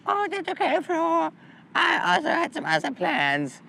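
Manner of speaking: squeaky sweet friend brushing it off; imitating Kelly. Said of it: "she's like".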